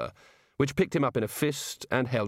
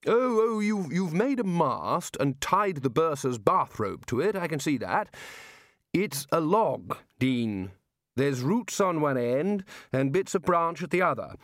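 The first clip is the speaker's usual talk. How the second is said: higher, exasperated wizard; Ridcully's voice; 5 semitones higher than usual. Acted. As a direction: scoff at it impatiently. Ridcully scoffs.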